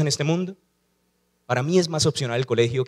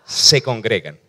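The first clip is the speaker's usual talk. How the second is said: call and response; 3 semitones lower, 5 dB louder, slower than usual. Shown as a demonstration. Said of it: "Dígalo conmigo".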